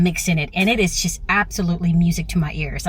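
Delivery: ordinary talk, nothing marked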